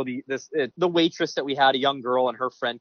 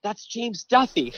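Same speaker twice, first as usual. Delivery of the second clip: mimicking the girl; 8 semitones higher, slower than usual. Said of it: "the one girl says to the other".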